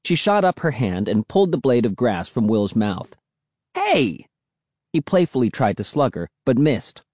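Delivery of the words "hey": sudden high-pitched "hey"; protesting yelp. A narrator's reading. Will objects as Cassie snatches his grass, high yelp.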